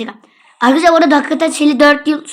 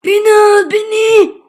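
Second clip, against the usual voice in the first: pleading voice; 7 semitones higher, 3 dB louder than usual. Pretend. Pleading in character voice.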